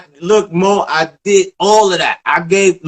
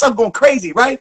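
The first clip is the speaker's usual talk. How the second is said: normal talking voice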